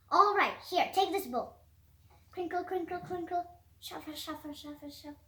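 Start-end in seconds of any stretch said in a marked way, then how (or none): 2.3-5.2 soft, fading "crinkle" and "shuffle"; quiet spoken sound effects. A narrator's reading. Narrator softly voicing snack-bag sounds, fading murmur.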